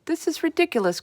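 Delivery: high scornful outburst; indignant cry. A narrator's reading. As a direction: scoff in outrage. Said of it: "cried the Air Marshal".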